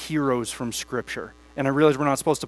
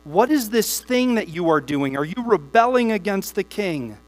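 steady, measured Bible reading to the church; congregation-facing scripture reading; 4 semitones higher than usual. Acted. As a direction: read the passage aloud with weight. Reads long Nehemiah passages.

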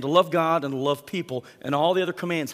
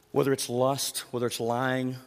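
ordinary talk

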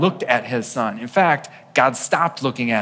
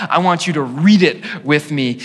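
ordinary talk, nothing marked

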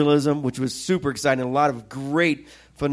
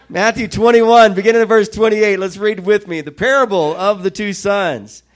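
raised, projected sermon reading; scripture reading; 3 semitones higher, 4 dB louder than usual. Acted. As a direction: read the parable out to a congregation. Reads scripture aloud to congregation.

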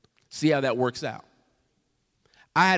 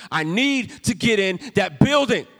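louder, higher and insistent; angry demand; 6 semitones higher, 5 dB louder than usual. Retold or imitated. Stranger demanding to enter the closed church, louder. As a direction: push the demand at the pastor.

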